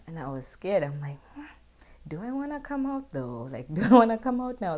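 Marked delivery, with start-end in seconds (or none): 1.3-4.8 nervous laughing doubt. Retold or imitated. Hesitant, scared, laughing.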